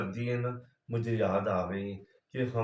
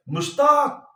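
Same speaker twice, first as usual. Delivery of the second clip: a sharp, much higher shout of his name; sudden loud call; 12 semitones higher, 9 dB louder than usual. Retold or imitated. Wife suddenly calling her husband's name loudly mid-prayer, loud sharp call. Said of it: "इसने इतनी तेज़ी से आवाज़ दी".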